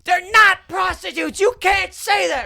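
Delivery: very high, loud, drawn-out screech; snapping outraged woman. Retold or imitated. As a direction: imitate a heckler losing it, each word stretched. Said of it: "absolutely snaps and goes".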